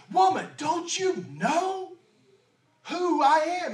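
Jesus proclaiming, not boasting; bold declaration. Acted. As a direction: call out "woman" with full authority. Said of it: "doesn't have an ounce of pride or arrogance".

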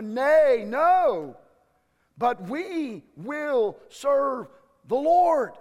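people shouting their vow. Acted. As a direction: cry it out loud and high. Preacher acts out people's shout.

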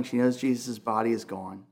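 ordinary delivery

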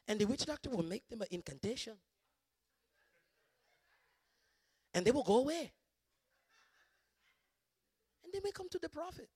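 storytelling in an imitated African accent; measured African accent, long pauses. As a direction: recount it step by step in an African accent. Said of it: "African accent".

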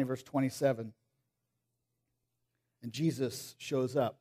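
usual speaking voice

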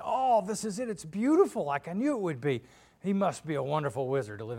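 high, loud burst of wonder; thrilled. Acted. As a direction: exclaim it in delight, then settle. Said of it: "they're thrilled".

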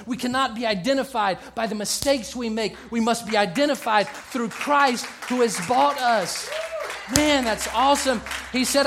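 high, loud, rousing pulpit declarations; fervent, hammering sermon climax; full-force preaching. Acted. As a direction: preach it as a rising chant of affirmations.

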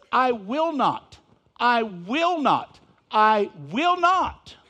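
I WILL NOT, each word drawn out and loud; weighty, deliberate stress on every "will not"; emphatic repetition. Shown as a demonstration. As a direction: hammer "I will not" slowly, pausing between repeats. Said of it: "So they emphasize the emphasis".

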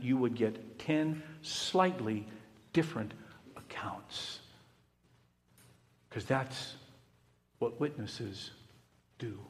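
slow, fading down to a whisper; hushed. Real, not acted. Preacher to congregation, trailing off to a whisper. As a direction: let it drop to a whisper at the end.

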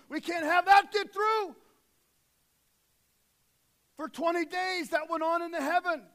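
loud, driving sermon delivery; booming pulpit voice; full-force preaching. Acted. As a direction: preach it to the back row.

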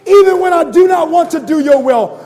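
high, loud shouted prayer; preacher begging God to chase him; impassioned preaching. Real, not acted. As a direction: cry out to God with full force.

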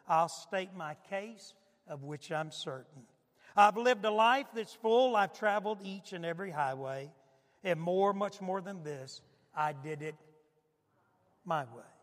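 dramatic recitation of song lyrics. Retold or imitated. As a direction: read the lyrics out like a poem, with feeling. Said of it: "I ran this off, [name]'s My Way lyrics".

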